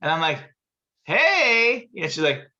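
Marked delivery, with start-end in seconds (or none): 1.0-1.9 bright raised mock greeting; loud high hey; calling out hey. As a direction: call her over, pitched up. Calling out to her.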